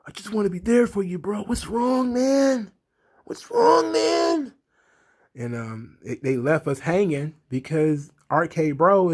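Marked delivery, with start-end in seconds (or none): 0.1-4.5 higher, airy surfer-bro whine; mock Riddle bro voice. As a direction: ham up a clueless, eager bro. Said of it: "And he's like".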